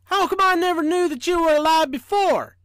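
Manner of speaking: high, loud, incredulous engineer; amazed. A narrator's reading. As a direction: ask it loudly in wide-eyed wonder. Amazed engineer questions the living train.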